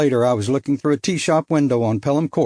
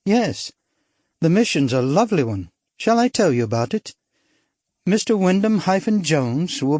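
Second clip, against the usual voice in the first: put-on lighter, higher bright young woman; spirited society girl, higher; young woman character; 4 semitones higher than usual. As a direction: play her as a bold upper-class girl. Narrator voices young woman.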